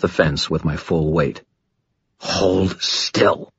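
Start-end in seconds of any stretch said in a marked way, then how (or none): 2.2-3.5 tense snarl at usual pitch; snarled command. A narrator's reading. Pinning a man to the fence, snarled. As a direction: snarl it as a hard order. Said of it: "I snarled".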